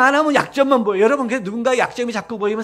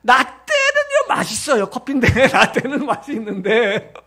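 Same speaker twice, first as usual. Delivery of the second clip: hammed-up old-timer; slower than usual. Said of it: "그러니까 어른들".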